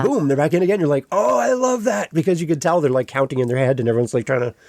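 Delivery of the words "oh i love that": high, slow, savoring oh-I-love-that; delighted listener. Retold or imitated. Concert-goer admiring a well-timed pause, high and delighted. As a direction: let the pleasure stretch out.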